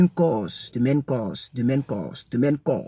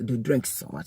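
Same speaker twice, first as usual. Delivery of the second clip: ordinary delivery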